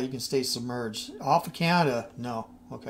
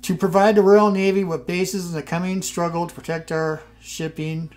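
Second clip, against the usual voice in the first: formal event read; 2 semitones higher, 6 dB louder than usual. Acted. Reading the Foreign Secretary's message aloud, formal and raised. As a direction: read it like a wartime government briefing. Reads in-game diplomatic event text.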